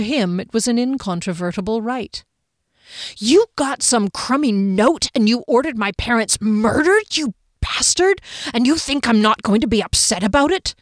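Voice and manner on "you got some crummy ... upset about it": outraged, accusing growl; angry growl. A narrator's reading. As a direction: let the anger growl through. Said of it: "didn't try to keep the growl out of her voice".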